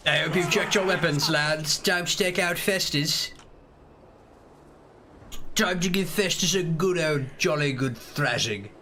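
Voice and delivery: hammed-up British Empire soldier accent; jolly Empire accent, addressing the lads. Shown as a demonstration. Soldier telling the lads to take out Festus, Empire accent. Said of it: "I'll do an Empire accent".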